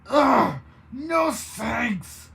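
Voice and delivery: loud, high snarling refusal. A narrator's reading. Baroness snarls at the cowboy refusing a drink. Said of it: "Baroness snarled".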